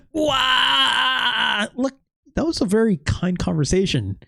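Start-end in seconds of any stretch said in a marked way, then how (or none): 0.1-1.7 mock wail. Player fake-crying over the retirement talk. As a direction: bawl like a baby, hammed up.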